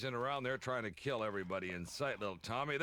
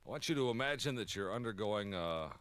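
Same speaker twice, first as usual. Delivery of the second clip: usual speaking voice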